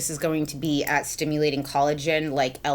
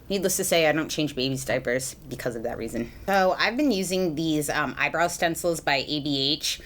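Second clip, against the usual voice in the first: usual speaking voice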